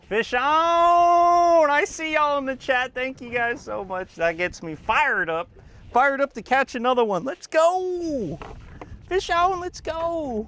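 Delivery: high, loud hype shouting; fired-up yell to the chat; excited shout. Real, not acted. Hooks another fish live.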